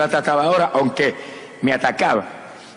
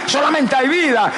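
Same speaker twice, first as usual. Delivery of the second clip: vida cried out high and slow; shouted slowly; 9 semitones higher, slower than usual. Acted. Preacher shouting that only life remains, high and slow.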